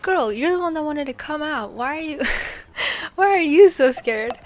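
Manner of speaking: exasperated big-sister retort, acted out; retold exasperation. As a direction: tell off a jumpy sister, half laughing. Retold scolding of nervous sister.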